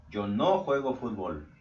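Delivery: "no" stressed, "Yo no juego fútbol" said slowly with a falling end; negative statement. Shown as a demonstration. Teacher models the negative form for students, slow with "no" stressed. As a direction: lean on "no" to make the denial clear.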